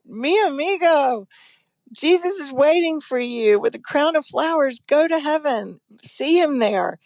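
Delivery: high, tender sing-song farewell; imitating the movie mother. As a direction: coo it like the mother from the film. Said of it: "So when I was telling my son".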